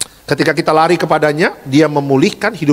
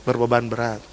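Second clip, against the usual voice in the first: softer, laughing repeat of "Berbeban berat"; 4 semitones lower, 9 dB quieter than usual. Real, not acted. Pastor laughing at his own joke about the burden.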